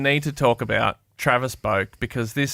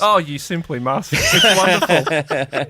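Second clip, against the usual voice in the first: mock gushing; 5 semitones higher, 7 dB louder, slower than usual. Mock posh praise joke.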